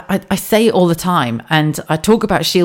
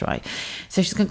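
ordinary talk

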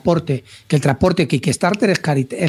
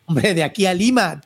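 ordinary talk

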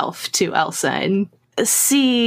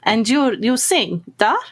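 put-on polite Russian-accented request; 4 semitones higher than usual. Asking Elsa to sing, polite Russian accent. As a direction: ask hopefully in a Russian accent. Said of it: "German with a Russian accent".

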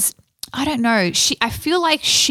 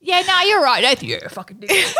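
Aussie impression; 7 semitones higher, faster than usual. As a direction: throw it out like a cheeky Aussie mate. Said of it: "That's Aussie".

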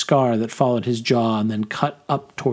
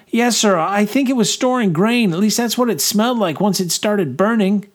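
high, eager soldier; young corporal voice; 9 semitones higher than usual. A narrator's reading. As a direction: answer the major crisply. Corporal answers major.

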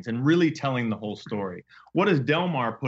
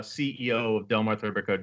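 ordinary talk

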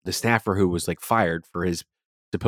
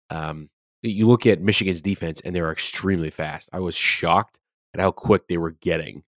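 normal talking voice

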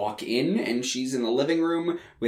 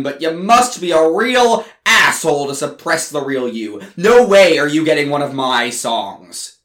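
angry; 9 dB louder than usual. Retold or imitated. Berating her over fake image.

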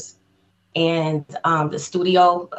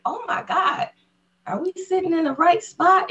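high-pitched awed question; squeaky, amazed retelling; starstruck disbelief; 12 semitones higher than usual. Retold or imitated. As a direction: re-enact being overwhelmed at where you are. Starstruck quote at idol's desk.

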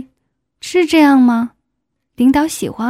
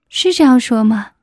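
ordinary delivery

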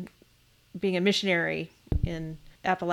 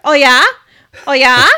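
very loud, high mock Midwestern "Oh, yeah?"; mock Dakota barber; 5 semitones higher, 14 dB louder than usual. Imitating the barber who asked her husband questions, loud and high. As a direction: belt it out like a chatty small-town barber. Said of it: "She's like".

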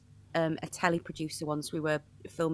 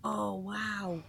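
stunned quiet wow; 4 semitones higher, 10 dB quieter, much slower than usual. Retold or imitated. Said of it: "And I was like".

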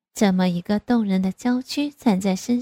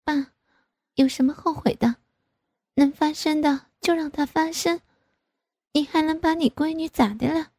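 higher-pitched voice, playfully brushing it off; teasing daughter; 7 semitones higher than usual. A narrator's reading. Grown daughter brushing off her father's worry, teasing. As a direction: make light of it in a higher voice. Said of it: "我嘻嘻地笑着".

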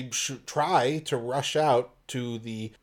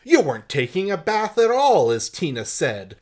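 accusing cry, a good deal higher and louder; cried out; 6 semitones higher, 7 dB louder than usual. Acted. George catches Anton in a lie, loud accusing cry. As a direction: call him out, catching a liar. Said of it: "George cried out".

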